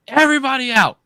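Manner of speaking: high, loud shouted command; dad shouting everyone out of the bouncy castle; shouted order. Retold or imitated. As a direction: clear them all out with a yell. Kicking kids out of inflatable.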